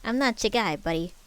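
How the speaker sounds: badly faked Canadian, dropping at the end; worse Canadian accent. A narrator's reading. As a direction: fire back in a clumsy Canadian accent. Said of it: "Terry retorted in an even worse Canadian accent".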